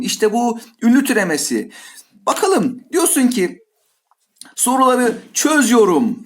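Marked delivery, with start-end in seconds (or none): none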